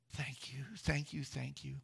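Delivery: whispered, weeping thanks; tearful whisper. Retold or imitated. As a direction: breathe the thanks through tears. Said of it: "She wept".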